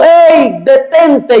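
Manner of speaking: high, urgent call to stop someone; retold shout. Paul calling to the jailer about to kill himself, shouting. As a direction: call out sharply, pitch jumping well up. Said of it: "Pablo le gritó y le dijo".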